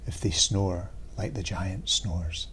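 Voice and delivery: usual speaking voice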